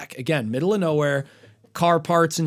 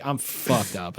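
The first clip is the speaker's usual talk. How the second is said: low, quiet stoned murmur; relaxed, hushed aside to yourself; chilled out; 9 semitones lower, 10 dB quieter, slower than usual. Retold or imitated. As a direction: say it softly and low, totally mellow. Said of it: "at first I'm chilling".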